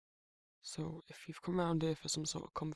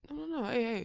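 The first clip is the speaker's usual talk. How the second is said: hurried protest; 8 semitones higher, 8 dB louder than usual. Acted. Hurriedly stopping visitor from leaving.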